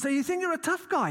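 high, taunting tough-guy jeer; a hammed-up thug challenge; mock tough guy. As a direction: goad him with a sneer. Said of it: "He goes".

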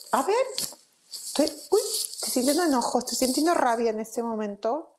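high, surprised voice, catching her own anger; high startled inner voice noticing anger; startled self-talk. Pretend. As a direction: gasp as you notice you're angry.

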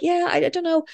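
high, small-voiced imitation of dodging a question; evasive reply. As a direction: mimic yourself fobbing friends off, high-pitched. Acts own evasive reply.

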